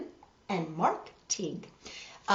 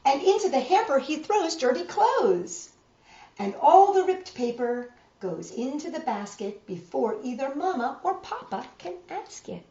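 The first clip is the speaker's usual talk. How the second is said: storybook reading voice; reading to children; 5 semitones higher, 5 dB louder, slower than usual. A narrator's reading. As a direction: read it like a bedtime picture book. Reads picture book to children.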